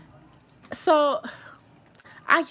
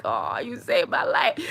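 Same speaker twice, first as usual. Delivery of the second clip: whispered, overcome thanks, acted out; retold grateful whimper. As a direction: whisper your gratitude to a rescuer. Retold tearful gratitude after rescue.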